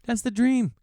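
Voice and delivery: high, gushing repeat of the dream; a high, hammed-up swoon; mock gushing. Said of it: "I'm like".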